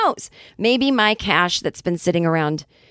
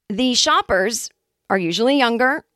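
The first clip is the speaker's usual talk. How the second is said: ordinary talk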